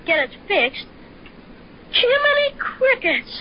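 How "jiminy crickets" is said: amazed gasp. Acted. Discovering bear through telescope.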